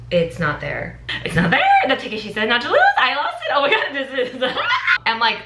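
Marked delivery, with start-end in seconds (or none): 1.2-4.4 rising, panicked, laughing; higher, frantic, laughing panic. Retold or imitated. Panicking about the lost ticket. 4.5-5.0 high panicked laugh. Retold or imitated. Panic over lost ticket.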